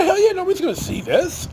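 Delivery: pleased with the bargain; delighted at the discount; gleeful hell yeah. Retold or imitated. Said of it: "I thought".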